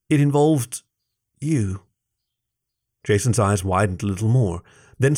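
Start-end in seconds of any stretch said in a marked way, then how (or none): 0.1-1.8 halting, reluctant confession; hesitant pauses. A narrator's reading. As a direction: break off before the last word. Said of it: "pausing for a second or two".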